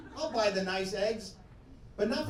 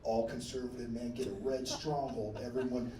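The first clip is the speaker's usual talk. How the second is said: much lower, softer mock voice; muttered conservative caricature; 8 semitones lower, 6 dB quieter than usual. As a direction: sink low and quiet for the caricature. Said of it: "what do they say".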